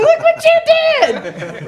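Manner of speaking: very high, drawn-out gush; hammed-up proud baby talk; squealing mock praise. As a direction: squeal it to a toddler, over the top. Acted adult praising a child.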